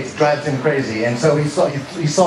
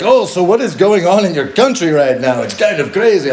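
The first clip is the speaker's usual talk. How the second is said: bad Icelandic accent that slips into Russian, pitched higher; botched Icelandic accent; 6 semitones higher, 4 dB louder than usual. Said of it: "I don't know why he sounds Russian".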